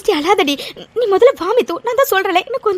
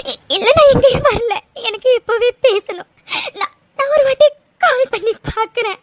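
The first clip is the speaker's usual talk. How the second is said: stammering through sobs; tearful refusal. Acted. Mithu refuses to go to her room, tearful refusal. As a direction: refuse while crying, desperate. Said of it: "அழாதடி".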